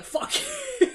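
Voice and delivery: high, loud, laughing curse; playful mock cuss-out, laughing; laughing mock sass. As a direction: sass them through a laugh. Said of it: "sass all the people ... and be like".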